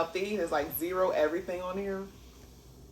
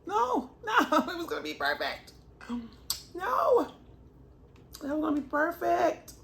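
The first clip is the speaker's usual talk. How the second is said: dismayed cry; 11 semitones higher, 3 dB louder than usual. Real, not acted. Sushi falls apart.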